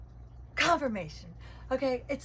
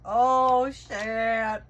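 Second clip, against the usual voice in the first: louder, sudden surprise; startled swear; 7 dB louder than usual. Real, not acted. Reader blurting as the same card comes up again. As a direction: blurt it out, caught off guard.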